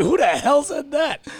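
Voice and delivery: high, loud, slow mock disbelief; hammed-up, high-pitched indignant question; incredulous outburst. Retold disbelief at a compliment.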